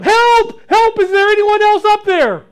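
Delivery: very high, loud panicked cries; desperate yell. Acted. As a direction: scream up for anyone else. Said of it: "Jack yelled".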